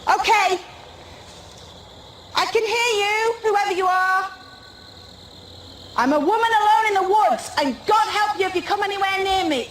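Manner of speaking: high defiant shout. Real, not acted. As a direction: yell it out to scare them off. Threatening unseen presence in woods.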